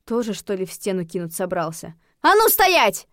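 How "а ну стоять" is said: high, loud halting shout; sharp, piercing command; barked command. A narrator's reading. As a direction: bark the order to stop. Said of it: "рявкнула я".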